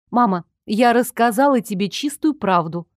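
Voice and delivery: ordinary delivery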